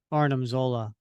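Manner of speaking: usual speaking voice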